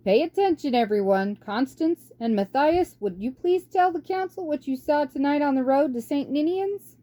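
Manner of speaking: put-on calm the council like a kindly old monk; old abbot.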